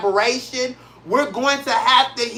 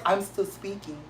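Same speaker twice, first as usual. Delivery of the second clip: much softer, calm "I'm still speaking"; a light hint of Kamala Harris, poised and quiet; Kamala Harris impression; 2 semitones lower, 11 dB quieter than usual. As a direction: politely cut the interrupter off. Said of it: "I do my Kamala Harris first".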